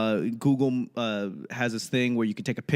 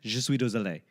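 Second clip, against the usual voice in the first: fast, apologetic tourist French, pardonnez-moi; halting schoolbook French with an American accent. Shown as a demonstration. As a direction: rattle off your best classroom French. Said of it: "the big thing you wanna learn is".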